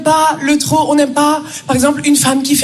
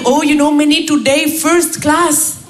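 slow, blissful Indian English; Indian accent; slower than usual. Retold or imitated. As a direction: stretch out first class in an Indian accent. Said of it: "il arrive". (Raj, accent indien, tout content)

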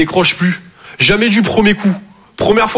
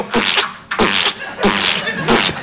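soft unvoiced puffs of a worn-out worker; mock exhausted puffing; 5 dB quieter, much slower than usual. Arriving at the shop covered in paint, soft tired puffs. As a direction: puff quietly like you've worked all day.

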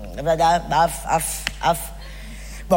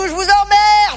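sudden high burst on emmerde; punchline snap; 8 semitones higher, slower than usual. Acted. Comic widow spitting the insult at the audience. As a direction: fling the insult at the crowd. Stand-up punchline, defiant outburst.

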